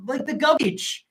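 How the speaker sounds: ordinary talk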